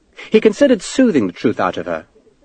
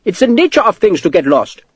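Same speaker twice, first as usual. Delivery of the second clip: higher-pitched, feeble clerk's excuse; weakly; 7 semitones higher than usual. A narrator's reading. Sweating clerk to Sharp, weakly. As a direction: offer it as a lame excuse. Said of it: "said again weakly".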